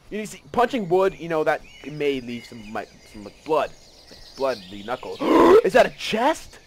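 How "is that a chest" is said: gasp, then high, loud question; loud, high-pitched excited discovery; thrilled surprise. Real, not acted. Spots a chest.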